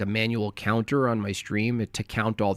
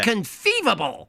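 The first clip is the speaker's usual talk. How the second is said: mock Vizzini; 9 semitones higher than usual. Quoting Princess Bride villain.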